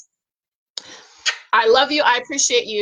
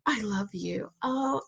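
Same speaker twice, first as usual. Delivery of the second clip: soft, tender gratitude, much softer; tender thanks; 7 dB quieter than usual. Retold or imitated. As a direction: murmur your thanks lovingly. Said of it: "he wanna hear".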